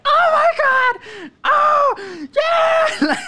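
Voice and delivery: very high, drawn-out screams; hysterical fake excitement. Faking a cruise win for scammers, screaming ecstatically. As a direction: scream like an overjoyed prize winner. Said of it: "I break down on the fucking phone".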